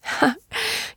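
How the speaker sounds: short, high-pitched laugh; high little burst of laughter; light laugh. A narrator's reading. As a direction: give a quick little laugh, pitched well up. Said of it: "she laughed".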